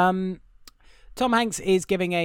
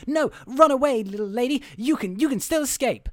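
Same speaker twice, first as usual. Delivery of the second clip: mock urgent plea; 10 semitones higher than usual. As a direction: urge her away, acted out. Acts out urging girl to flee.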